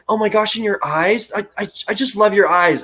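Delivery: mild mock gush of an admiring daughter. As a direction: swoon over the eyes. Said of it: "the daughter goes".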